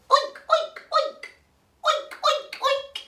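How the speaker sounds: very high, staccato oinks; playful pig oinks. Pretend. Storyteller finding the piggy in the bag, squeaky oinking.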